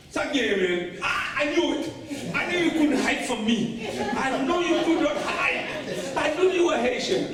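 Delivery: high, insistent exclamation, slowing for emphasis; triumphant recognition. Acted. Old man unmasks a hidden Haitian, high-pitched and triumphant. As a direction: crow it like you've caught him out.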